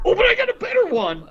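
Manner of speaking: very high, excited imitation; squeaky, over-keen mock voice; eager joke teller. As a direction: imitate someone gleefully pushing another bad joke.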